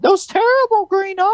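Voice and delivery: high-pitched hammed-up old aunt cry; mock terrified wail. Quoting Aunt May's frightened cry.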